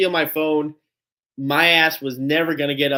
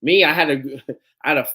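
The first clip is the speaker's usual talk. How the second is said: usual speaking voice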